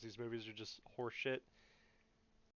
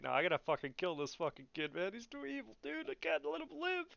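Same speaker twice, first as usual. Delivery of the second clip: mock Luke climbing into a high-pitched panic; mock panicky Luke; 8 semitones higher than usual. Mocking new Luke deciding to kill Ben, high mock panic. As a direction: squeak it out like a freaked-out hero. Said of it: "and Luke's like".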